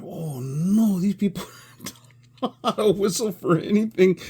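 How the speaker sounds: high, loud wail breaking into laughter; laughing dismay. Retold or imitated. Reacting to singers who can't whistle, laughing dismay. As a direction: laugh through a retold "oh no".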